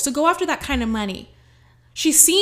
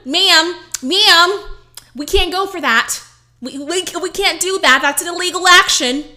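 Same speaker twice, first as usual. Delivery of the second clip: police officer voice; 6 semitones higher, 7 dB louder than usual. Acted. Coach voicing her inner child as a cop. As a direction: play the inner child as a cop laying down the law. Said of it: "the inner child is a police officer".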